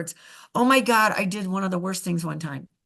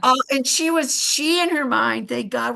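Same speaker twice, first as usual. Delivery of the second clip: ordinary talk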